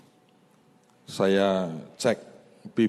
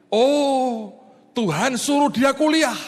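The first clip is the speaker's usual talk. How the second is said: much higher and louder, eager and sure; the sudden "I get it" thought; eager realization; 9 dB louder, slower than usual. Retold or imitated. Pastor recalling his first guess about God's message, eager and loud. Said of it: "Saya pikir".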